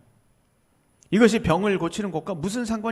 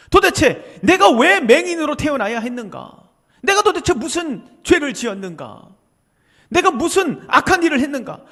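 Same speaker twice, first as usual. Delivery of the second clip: louder, pleading questions of a wronged man; lament of the blind man; 4 semitones higher, 6 dB louder than usual. Acted. Blind man protesting his lifelong curse, mild anguish. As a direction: cry out like a cursed man asking why.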